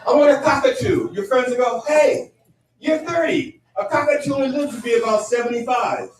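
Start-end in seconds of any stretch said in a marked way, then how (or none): 0.1-1.1 high, loud mock-eager voice; eager friend. Friend announcing wish for a cockatoo, mock-eager. 1.9-6.1 high, hammed-up friend voice; breezy mock reassurance, pitched way up; upbeat friend. Friends approving a pet at thirty, exaggeratedly upbeat.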